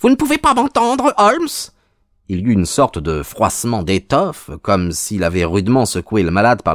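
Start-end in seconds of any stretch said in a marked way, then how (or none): 0.0-1.5 very high, insistent calling voice; waking a sleeper. A narrator's reading. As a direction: try to rouse the unconscious man. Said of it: "quelqu'un qui aurait voulu réveiller un dormeur". (voix suraiguë qui veut réveiller)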